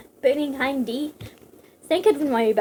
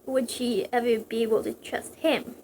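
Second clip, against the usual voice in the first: plain reading voice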